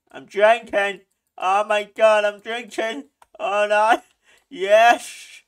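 usual speaking voice

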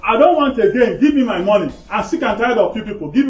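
loud, fed-up outburst; angry demand. Acted. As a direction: demand it loudly, out of patience. Creditor angrily demanding his money.

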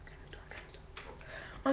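slow breathless whisper to herself; whispered self-calming. Real, not acted. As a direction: hush yourself down while catching your breath. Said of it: "je suis un peu essoufflée".

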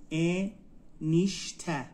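انیشته very slowly as ا، نیش، ته; slow teaching demo of انیشته, split into syllables. Shown as a demonstration. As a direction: sound out انیشته in three separated beats. Teacher breaks word into syllables.

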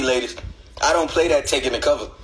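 ordinary talk

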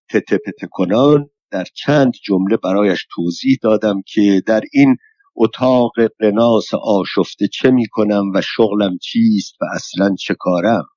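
ordinary delivery, nothing marked

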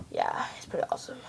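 quick breathy whisper. Acted. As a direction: rush it under your breath. Customer's whispered testimonial.

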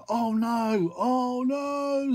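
high, alarmed oh no; panicky toy car. Acted. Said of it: "said the car".

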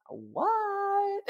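mock shock at the famous name; stunned what. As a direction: shoot the pitch way up in disbelief. Stunned reaction to Ovechkin news.

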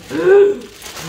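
a sharp, loud gasp of wonder; retold gasp. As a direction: gasp as if seeing it first. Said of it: "I saw that and I went".

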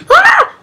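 sudden high yelp of pain; short high scream of pain. Real, not acted. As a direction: yelp as the blade hits. Knife stabbed into buttocks.

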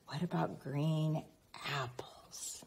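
soft whisper; hushed clue; whispered hint. Acted. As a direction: let the child in on a secret answer. Hinting the answer to a child.

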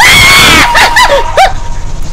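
very high shocked shriek; shrieking laugh-scream. Real, not acted. Player sees the bus and shrieks with shocked laughter. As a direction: let out a huge startled shriek.

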